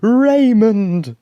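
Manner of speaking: high, very loud drawn-out call; full-voiced mother's shout. A narrator's reading. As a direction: bellow the child's name joyfully. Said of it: "shouted out loudly".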